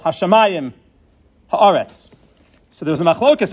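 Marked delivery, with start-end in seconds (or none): 0.0-2.1 "Hashamayim", pause, "Haaretz", each stressed on the second-last syllable. Shown as a demonstration. Teacher repeating the exceptions, second-last-syllable stress on "Hashamayim Haaretz". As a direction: push the emphasis back to MA in "Hashamayim" and A in "Haaretz". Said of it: "pushed up to the second last syllable".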